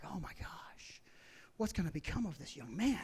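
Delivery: put-on whispered pity for the young man; hushed awe. Drivers whisper about the fallen man, hushed pity. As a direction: breathe it out like a gawking crowd.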